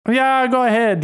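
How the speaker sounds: high mock-Jamaican, slipping towards Irish; bad Jamaican accent. Nurse letting him tell his joke, bad Jamaican accent. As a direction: put on a botched Jamaican accent. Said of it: "She sounds Irish".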